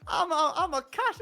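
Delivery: role-play Australian cashier; attempted Australian accent. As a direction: have a stumbling go at an Australian accent. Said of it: "speak to me like you're speaking to me at the shops".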